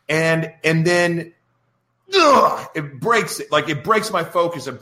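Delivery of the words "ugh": high, loud groan of exasperation; a sudden fed-up "ugh"; frustrated ugh. Retold or imitated. Host reliving a call breaking his focus, exasperated groan.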